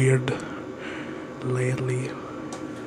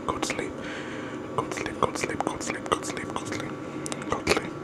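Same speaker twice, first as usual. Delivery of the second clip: soft repetitive sleepy whisper; ASMR whisper; 8 semitones higher, 7 dB quieter than usual. Acted. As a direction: lull them to sleep in a whisper.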